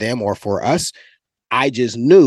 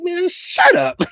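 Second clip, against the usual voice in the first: high, scornful "Man, shut up"; a mock-high dismissive retort; dismissive brush-off; slower than usual. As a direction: wave off a teammate mid-game. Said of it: "I look at him like".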